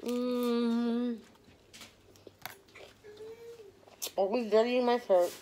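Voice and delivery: chewing while talking; muffled by food; mouth full. Real, not acted. Seen 0: bites taco at 0.2, cheeks full and chewing through 3.8.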